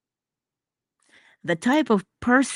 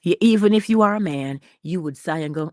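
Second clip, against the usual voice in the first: ordinary talk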